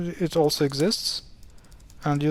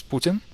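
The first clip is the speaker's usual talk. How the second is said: "Putin" stressed up front, POO-tin; the proper "Putin", POO-tin; first-syllable stress; 3 semitones higher than usual. Shown as a demonstration. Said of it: "The emphasis is on the first syllable".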